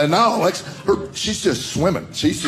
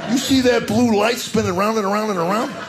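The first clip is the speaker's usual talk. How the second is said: soothing husband; 8 semitones higher than usual. Retold or imitated. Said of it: "I put my hands on her shoulder".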